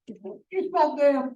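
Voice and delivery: high, loud, drawn-out seventh-grader mimicry; seventh-grade response. Teacher mimicking the class's reaction, seventh-grade voice. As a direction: imitate a seventh grader gleefully calling out, slowly. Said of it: "We get the seventh grade response".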